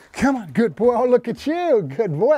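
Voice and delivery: high-pitched praise to a dog; real delighted praise for a dog; excited dog praise. Real, not acted.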